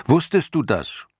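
put-on high eager boy asking; young boy voice. Boy hero urges.

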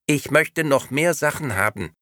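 sweet, empty doll voice; talking doll. Acted. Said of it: "antwortete die Puppe".

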